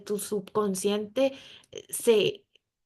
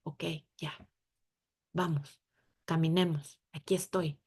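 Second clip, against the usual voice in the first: calm reassurance; 3 semitones lower, 3 dB quieter than usual. Pretend. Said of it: "esa paz y esa tranquilidad de decir".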